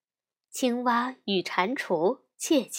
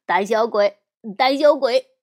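loud, punched taunt; teasing; 9 dB louder than usual. A narrator's reading. Brother teasing his little sister, loud taunting. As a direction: taunt her loudly. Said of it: "小熊哥哥逗着小熊妹妹".

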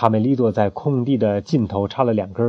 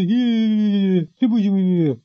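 higher, recoiling refusal; scared refusal; 8 semitones higher, slower than usual. Acted. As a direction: back away in fright. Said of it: "吓得直往后退".

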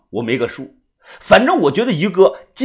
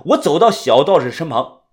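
plain reading voice

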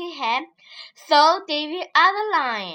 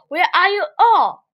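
loud, high roaring call; lion roaring for the hidden animals; 4 semitones higher, 9 dB louder than usual. Acted. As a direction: bellow it to the hiding animals. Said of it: "roared lion".

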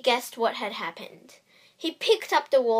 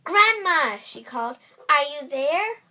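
calling out; 5 semitones higher, 8 dB louder than usual. A narrator's reading. Calling into grandma's cottage.

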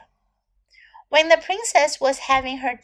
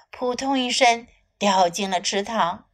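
usual speaking voice

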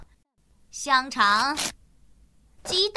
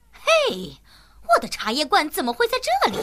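normal talking voice

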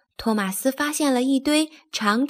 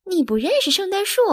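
high, quick, incredulous question; astonished exclamation; 9 semitones higher, much faster than usual. A narrator's reading. Duncan cries out at Thomas's ignorance, astonished. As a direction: make a big fuss in disbelief. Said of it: "大惊小怪地叫起来".

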